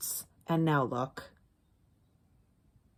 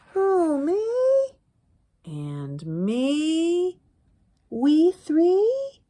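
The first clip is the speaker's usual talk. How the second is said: high, loud storybook voices; high playful picture-book voices; character voices; 9 semitones higher, 8 dB louder, much slower than usual. Acted. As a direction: act out the question and answer brightly.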